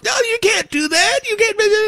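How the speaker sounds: high whiny mocking voice; mock whiny protester.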